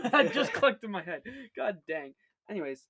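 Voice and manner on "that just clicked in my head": laughing as it clicks; a burst of laughter mid-line. Real, not acted. Sudden realization with laugh.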